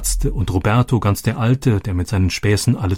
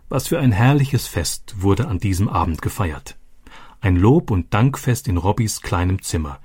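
ordinary delivery

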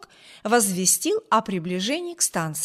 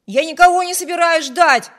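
higher, much louder boast; defiant shout; 7 semitones higher, 10 dB louder than usual. A narrator's reading. Wibert shouting back at his sister, defiant shout. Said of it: "крикнул Виберт".

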